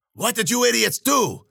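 high-pitched exasperated demand; irritated scolding. A narrator's reading. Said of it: "she gave me an irritated glare".

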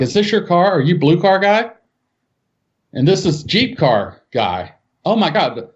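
high, startled questions; a cop suddenly realizing the mix-up; retold policeman piecing it together in surprise; surprised cop.

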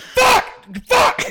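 high-pitched shouted outburst; imitated furious yell; mock yelling. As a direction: yell it hammed-up, like raging at an appliance. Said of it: "You just, like, yell at".

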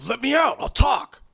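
high, loud plea from behind a shut door; desperate captive call. Acted. Trapped elf shouts to the party to be let out.

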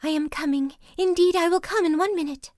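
a high, half-sobbed promise; sobbing wife. A narrator's reading. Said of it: "sobbed his wretched wife".

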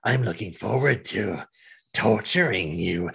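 a hint of a creepy sneering baddie; villain voice. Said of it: "he's like".

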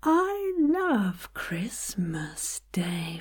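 "love" leaned on and stretched, very slowly; stress on LOVE. Shown as a demonstration. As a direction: swell into a long "love". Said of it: "Find a different place to put the emphasis".

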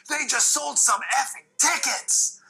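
high, slow, sing-song boast; fired-up Taker. Retold or imitated. Taker poking his head into Gorilla, high and drawn out. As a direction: draw it out proud and hyped. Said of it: "and he goes".

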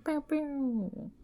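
squeaky laser pew pew; high "pew pew" sound effect. Pretend. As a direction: toss in a playful high laser noise. Playful cheer after patron name.

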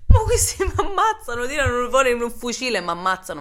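half-laugh, half-shout the fear; laughing panic. Retold or imitated. [name] imagining they'll shoot her, loud laughing panic.

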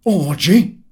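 loud, high, puzzled echo; a loud, rising "Orgy?" of mock surprise; surprised question. A narrator's reading. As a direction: toss the word back in disbelief. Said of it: "replied Mister Goyles".

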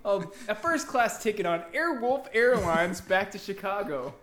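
game show host voice; booming prize announcer. Acted. Prize reveal to winner.

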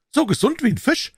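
put-on high, eager young man's voice; Offerton chiming in. A narrator's reading. Offerton interrupting Holmes with a suggestion, high and eager.

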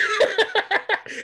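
high, loud cackle of ridicule; imitated mocking laugh. Mom starts laughing at her son, imitated cackle. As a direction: burst into hammed-up mocking laughter. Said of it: "she starts laughing at me".

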